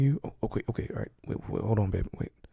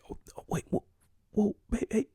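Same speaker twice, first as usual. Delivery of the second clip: normal talking voice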